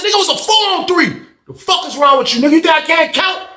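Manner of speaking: high, heated outburst; angry retort. Retold or imitated. Telling the friend it was four on three: angry outburst. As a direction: snap back at his excuse.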